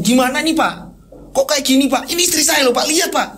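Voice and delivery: high-pitched, agitated demand for help; frantic pleading. Acted. Husband showing his wife to the shaman, frantic pleading. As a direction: press him desperately.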